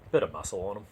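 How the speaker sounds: usual speaking voice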